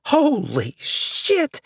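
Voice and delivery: very slow, high, each syllable separated; three distinct syllables. A narrator's reading. Shocked old woman, slow and syllable by syllable. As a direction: split it into three slow beats. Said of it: "she said in three distinct syllables".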